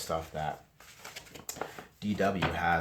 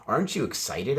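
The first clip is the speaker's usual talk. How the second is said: excited friend; 7 semitones higher, 7 dB louder than usual. A narrator's reading. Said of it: "asked Buster".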